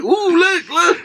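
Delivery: high, eager gawking voice; excited onlookers. Retold or imitated. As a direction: point and squeal like a tourist. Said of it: "everybody's like".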